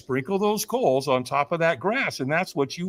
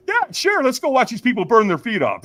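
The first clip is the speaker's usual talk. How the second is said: high, loud, gleeful acted thought; mock-eager agreement; 7 semitones higher, 7 dB louder than usual. Speaker agrees to watch others firewalk, mock eager. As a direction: jump at it with mock enthusiasm.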